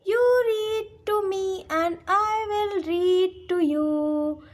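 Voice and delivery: put-on slow, sing-song children's verse with drawn-out words; stretched, lilting classroom poem reading; poem recitation. Shown as a demonstration. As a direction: recite the rhyme very slowly for young pupils. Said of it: "let's recite this poem".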